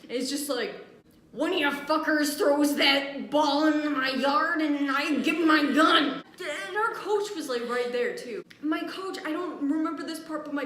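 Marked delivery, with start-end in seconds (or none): 1.3-6.2 put-on loud, drawn-out old man's holler; angry threat. Old man threatens kids from his fence, angry shouting. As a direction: bellow a warning like a cranky neighbor.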